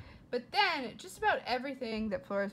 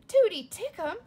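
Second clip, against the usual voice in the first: higher and louder in surprise; surprised; 8 semitones higher, 10 dB louder than usual. A narrator's reading. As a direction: say the name startled. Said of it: "said Flora".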